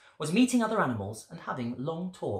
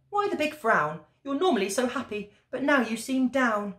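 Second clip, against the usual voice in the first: lightly voiced wife, higher pitched; a hint of a caring wife, pitched up; concerned wife; 8 semitones higher than usual. A narrator's reading. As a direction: ask with mild concern in a raised storybook voice. Wife's dialogue in a read story.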